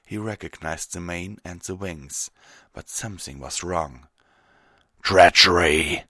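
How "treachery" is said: slow, loud, furious roar; snarled. Acted. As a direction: roar it out in rage. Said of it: "Fenrir snarled".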